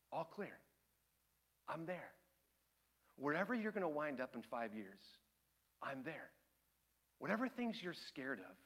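very soft, low and spaced out; hushed, solemn, calming voice; tender reassurance. Acted. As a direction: speak as God quietly comforting someone afraid. Voices God reassuring the listener.

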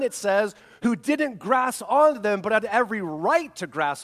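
loud, high, driving sermon build; preaching at full force. Acted. Pastor preaching to his church, loud and driving. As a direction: preach it hard to the congregation.

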